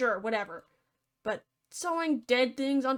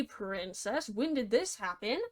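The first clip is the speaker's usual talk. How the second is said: ordinary delivery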